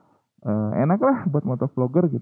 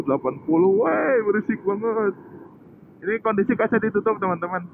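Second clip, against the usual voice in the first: shouting over wind; 9 semitones higher than usual. Real, not acted. Speeding up, wind roaring.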